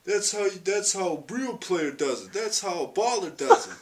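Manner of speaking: high, loud trash-talking brag; gloating. Retold or imitated. Friend rubs a basketball win in my face, gloating. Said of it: "rubbed it all in my face".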